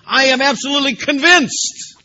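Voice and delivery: preaching Paul's words at full force; emphatic conviction. Acted. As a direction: declare it as beyond doubt. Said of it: "You see how emphatic he is?".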